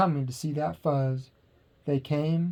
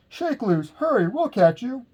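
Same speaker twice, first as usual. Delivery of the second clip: urgent calling; 8 semitones higher, 3 dB louder than usual. A narrator's reading. Calling up to Big Bark.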